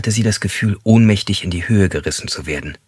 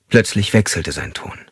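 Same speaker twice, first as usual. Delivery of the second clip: ordinary delivery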